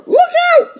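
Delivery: extremely high little-sister squeal of warning. Acted. Little sister warning her brother at the curb, extremely high squeal. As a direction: cry the warning in a tiny squeaky kid voice. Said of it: "said D W".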